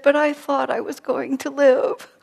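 put-on a dying woman sounding like a child; sweet childlike voice. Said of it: "how sweetly and sort of childlike she was".

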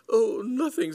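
high, flustered cover-up; nervous backing down. A narrator's reading. Cyril backing down before the explorer, high and flustered. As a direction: lose your nerve, pitched high.

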